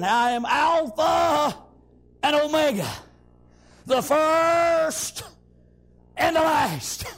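slow, proclaimed divine declaration; preacher imitating a great voice, words held long; great trumpet voice. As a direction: sound out each title like a trumpet. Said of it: "a great voice as of a trumpet".

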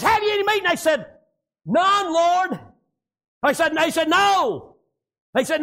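high, driving country sermon delivery; full-force preaching. Real, not acted. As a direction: preach it out hard, drawing out the line ends.

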